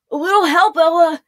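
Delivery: loud call for help mid-fight; yelled. Acted. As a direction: shout for help while dodging a blade. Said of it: "he yelled".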